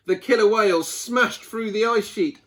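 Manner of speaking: ordinary delivery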